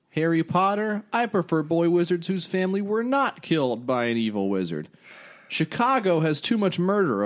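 high, blustery cartoon politician; cartoon Trump voice. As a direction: puff it up like a bragging cartoon president. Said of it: "this is in the voice of a cartoon Trump".

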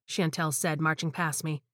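ordinary delivery

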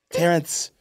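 put-on amateur British accent with a laugh in it; attempted British accent. Idris Elba to Terrence about past films, mock British accent. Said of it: "I don't know how to do a British accent".

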